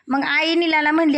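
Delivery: plain reading voice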